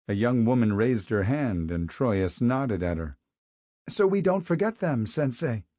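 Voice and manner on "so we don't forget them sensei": bright, higher, eager answer. A narrator's reading. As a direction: answer the teacher cheerfully. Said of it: "the woman said brightly".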